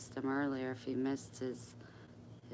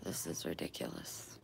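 whispering; 10 semitones lower, 8 dB quieter than usual. Real, not acted. Muttered aside.

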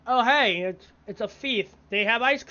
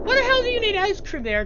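baffled disbelief; 12 semitones higher, 4 dB louder, faster than usual. Real, not acted. Baffled by puzzle item.

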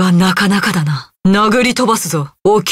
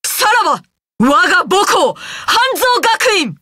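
ringing farewell cry; 9 semitones higher than usual. Acted. Warrior bidding her old school farewell, high ringing cry. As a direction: call out a grand farewell, pitched way up.

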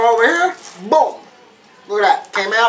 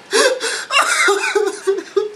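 a high real laugh; laughing while the onions sting; 10 semitones higher than usual. Real, not acted. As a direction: break into a giggle.

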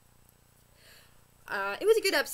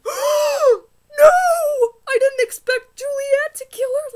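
shrieking, much higher and louder cry; horrified scream; 15 dB louder, slower than usual. Retold or imitated. Vlogger reliving her reaction to the show, shrieking cry. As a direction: scream in shock at the screen.

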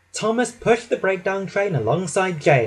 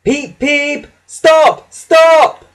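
very high, loud train-whistle cries; whistling engine; 12 semitones higher, 9 dB louder, much slower than usual. A narrator's reading. Thomas dragged too fast by Gordon, high whistling shout. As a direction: shriek for a halt like a whistle. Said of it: "whistled Thomas".

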